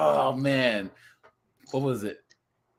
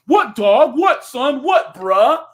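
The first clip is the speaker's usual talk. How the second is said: hammed-up wannabe rapper, higher and louder; mock wannabe rapper; 11 semitones higher, 8 dB louder, slower than usual. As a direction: bark it like a fake tough guy. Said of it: "they're like".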